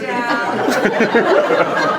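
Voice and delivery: a loud stagy laugh; mock melodrama laugh; hammed-up laugh. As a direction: burst into a theatrical laugh. Mother's laugh mid-scene.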